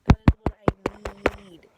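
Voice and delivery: very loud, drawn-out insistence; loud, slow, emphatic demand; emphatic outburst. Real, not acted. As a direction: blast it out, stretching the words slowly. Insisting on what they need.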